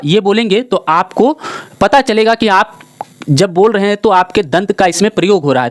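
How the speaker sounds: ordinary talk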